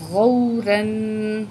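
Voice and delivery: غوراً said slowly, syllable by syllable; slow syllable reading. Shown as a demonstration. Teacher sounding out the word for the student. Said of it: "را دو زبر".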